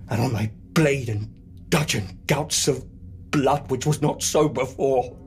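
rising alarm, forceful stage declamation; mounting horror. Acted. Macbeth seeing blood on the blade, mounting horror.

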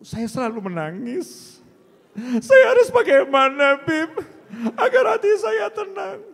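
high wailing woman's plea; mock crying woman. Preacher playing the wife, wailing her plea. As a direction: wail like a heartbroken wife.

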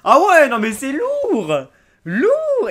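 loud, high, drawn-out rave; real excitement. Real, not acted. Unboxer raving over a new find, excited. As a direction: let it burst out high and loud, words stretched. Unboxer blown away by the item.

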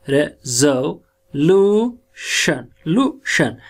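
रेजोल्यूशन built up sound by sound, very slow. Shown as a demonstration. Teacher sounding out the word for students, slowly. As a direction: drag out each piece of रेजोल्यूशन. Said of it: "ये हमारा बन जाता है रेजोल्यूशन".

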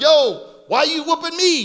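talking donkey. Acted. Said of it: "the donkey turns around and says".

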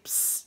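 a hushed hiss to catch someone's eye; psst whisper. Retold or imitated. Man hissing to get a woman's attention, whispered psst.

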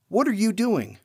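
ordinary talk

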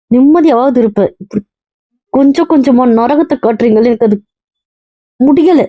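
high-pitched, desperate protest; anguished outburst. Acted. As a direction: plead at the end of your endurance. Wife breaks down in acted quarrel.

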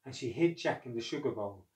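usual speaking voice

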